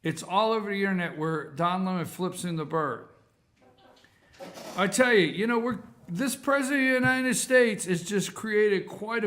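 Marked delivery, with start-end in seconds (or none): none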